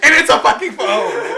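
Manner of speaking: high, very loud laughing shout; hysterical, full-volume disbelief; incredulous yelling. Real, not acted. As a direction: yell the punchline through laughter. Shouting the absurd plot twist.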